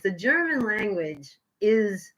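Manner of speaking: normal talking voice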